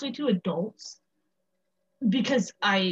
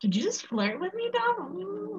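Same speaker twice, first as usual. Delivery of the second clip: teasing; 11 semitones higher, 3 dB quieter, slower than usual. Pretend. Teasing friend about flirting.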